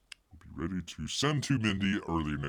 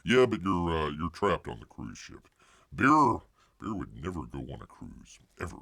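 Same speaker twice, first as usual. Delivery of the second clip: ordinary delivery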